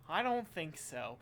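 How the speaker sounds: normal talking voice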